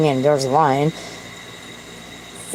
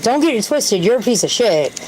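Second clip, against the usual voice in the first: higher-pitched mock reprimand from Karma; scolding Karma; 6 semitones higher than usual. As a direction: set them straight, pitched up and playful. Voicing Karma scolding the speaker.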